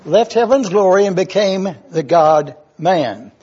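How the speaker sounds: normal talking voice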